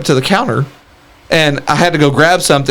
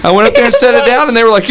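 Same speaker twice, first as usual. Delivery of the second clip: usual speaking voice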